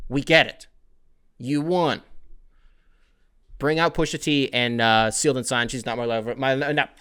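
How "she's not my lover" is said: usual speaking voice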